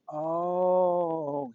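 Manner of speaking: loud, drawn-out "oh" of understanding; a long, loud acted "aha" moment; dawning realization. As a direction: imitate someone suddenly getting it. Retold doctor's moment of realization.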